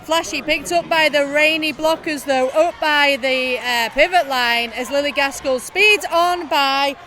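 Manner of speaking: loud, driving live commentary; play-by-play commentary. Acted. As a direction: call the action as it happens.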